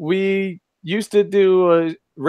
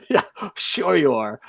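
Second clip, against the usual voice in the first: ordinary talk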